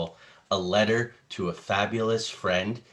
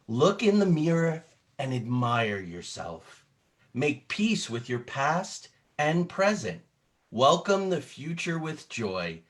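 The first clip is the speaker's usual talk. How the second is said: uplifting read-aloud climbing higher toward the end; dramatic storybook reading; 6 semitones higher, 4 dB louder than usual. Acted. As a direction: close the book with an encouraging lift. Reading picture book aloud dramatically.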